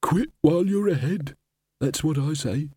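dry know-it-all voice; hollow skull voice. Acted. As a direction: advise like a smug old skull. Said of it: "said the skull".

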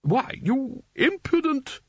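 outraged splutter. Acted. Spelter scolding the boy, outraged. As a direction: sputter at the cheek of it. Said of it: "began Spelter".

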